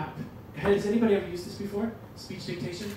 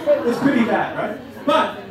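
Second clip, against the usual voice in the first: laughing; 3 semitones higher, 8 dB louder, slower than usual. Real, not acted. Laughs at bad Chinese.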